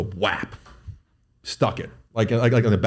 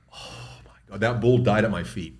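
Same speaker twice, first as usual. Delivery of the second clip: ordinary delivery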